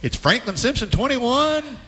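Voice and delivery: drawn-out radio call, rising high on the numbers; old-time play-by-play impression, lingering on each phrase; Kaywood Leopard impersonation. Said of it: "Is that your Kaywood Leopard impersonation?".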